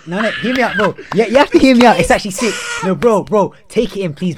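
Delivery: high, loud pleading insistence; loud insistent. Real, not acted. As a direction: beg him to listen, loud. Insists friend hears him out.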